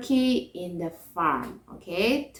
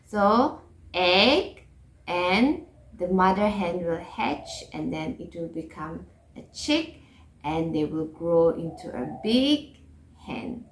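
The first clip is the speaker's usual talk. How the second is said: ordinary talk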